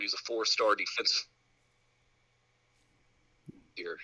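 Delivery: words dropping out on a bad line; cutting out. Real, not acted. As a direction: voice fading as the connection breaks. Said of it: "you cut out on us".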